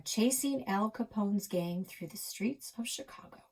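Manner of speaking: plain reading voice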